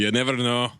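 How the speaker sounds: put-on Scottish brogue; jokey Scottish lilt; Scottish accent. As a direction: play the Scotsman chatting to the lads. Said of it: "use my Scottish accent".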